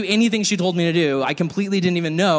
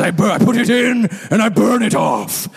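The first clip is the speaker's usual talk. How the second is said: booming pastor; 3 semitones higher, 3 dB louder than usual. Retold or imitated. Ken explains his eating.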